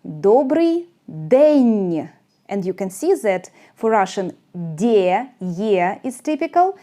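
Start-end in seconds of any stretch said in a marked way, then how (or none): 0.0-2.1 добрий день drawn out very slowly; very slow model of добрий день; slow pronunciation demo. Shown as a demonstration. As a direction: stretch добрий день so learners catch it. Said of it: "In Ukrainian".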